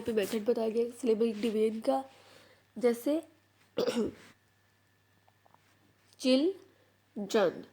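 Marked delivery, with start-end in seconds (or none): 6.2-7.6 "चिल, जन" split in two with a clear pause between; syllable by syllable. Shown as a demonstration. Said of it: "syllable को हम लोग कैसे divide करते हैं".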